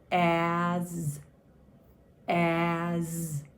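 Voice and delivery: AS drawn out with a long buzzing z; sounding out. Shown as a demonstration. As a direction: hold the vowel and the final z of AS. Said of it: "let's say the sounds in as".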